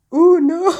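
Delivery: high, loud mock-panicked gasp; retold panic. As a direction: act out sudden comic panic. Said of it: "We were both panicking basically".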